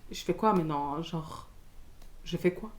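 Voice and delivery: low, soft and lost; re-enacted small, helpless voice; acted helplessness. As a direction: murmur it as if at a loss. Acts out being lost, tearful.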